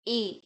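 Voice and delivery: A as a pure "ay"; French é sound. Shown as a demonstration. Teacher showing accented E, A as pure "ay". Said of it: "when E is pronounced as A".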